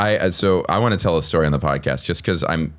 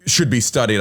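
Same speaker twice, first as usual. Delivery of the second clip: usual speaking voice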